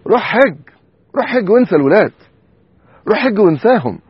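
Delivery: high, loud exhortation; urgent call. Real, not acted. Preacher urging the listeners to go on pilgrimage, shouted. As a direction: cry it out to the whole congregation.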